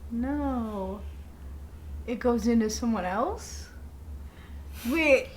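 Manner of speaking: usual speaking voice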